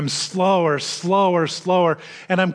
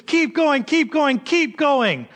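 cheering on; 10 semitones higher, slower than usual. Retold or imitated. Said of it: "And I was like".

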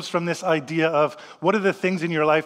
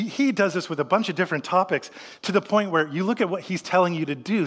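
usual speaking voice

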